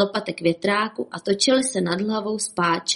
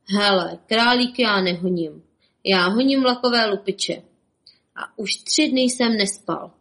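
ordinary delivery

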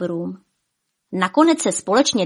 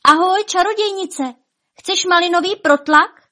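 very high, louder cheerful call; 11 semitones higher, 5 dB louder than usual. A narrator's reading. Birthday boy hails the witch unafraid, calling out. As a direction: call over with a high, lifted voice. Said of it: "Vilík zavolal".

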